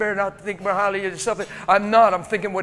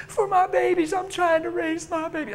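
higher pleading woman's voice; 9 semitones higher than usual. Retold or imitated. Prostitute explains herself to preacher, higher plea. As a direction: lift into her desperate plea.

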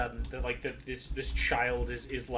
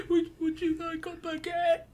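high, tearful offer; teary mayor; 10 semitones higher, 5 dB quieter than usual. Acted. The sobbing mayor offers a baguette, high and teary. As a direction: sniffle out the offer. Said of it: "the sobbing mayor's".

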